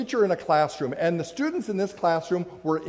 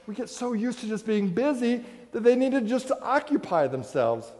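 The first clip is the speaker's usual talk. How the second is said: ordinary talk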